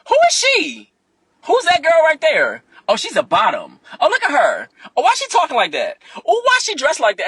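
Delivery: high, loud catty gossip; mocking his gossip. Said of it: "it went from ... to".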